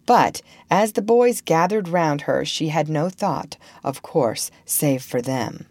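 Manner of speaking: ordinary delivery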